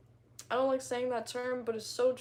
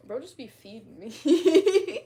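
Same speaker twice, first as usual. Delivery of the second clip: slow and louder through a laugh; 3 semitones higher, 8 dB louder, slower than usual. Real, not acted. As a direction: let the laugh spill into the words. Gushing about boyfriend, laughing.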